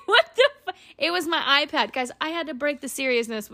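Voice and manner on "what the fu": high-pitched, giggling through the quote; laughing retelling. Real, not acted. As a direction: laugh while replaying your own shock. Retells her startled reaction, laughing.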